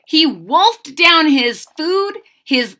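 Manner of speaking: lively rhyming read-aloud, bouncing; expressive children's story narration; storybook reading. A narrator's reading. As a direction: read to kids with sing-song energy.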